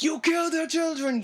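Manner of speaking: high, loud cry of grief and rage; anguished shout. Acted. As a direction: hurl it at the killer, voice cracking high. Acted quote of grieving fighter.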